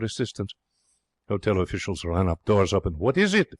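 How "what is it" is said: high, sudden alarmed question; alarmed cry. A narrator's reading. Hotel guests cry out from their doors in alarm. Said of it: "voices cry".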